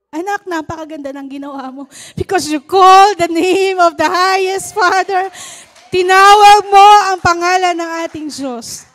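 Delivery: pastor's fervent praise; preaching. Acted. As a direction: preach it with conviction. Mother praising child, preaching.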